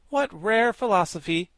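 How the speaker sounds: high excited admiring exclaim; admiring exclamation. A narrator's reading. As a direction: gush with admiration. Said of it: "exclaimed the Woggle-Bug admiringly".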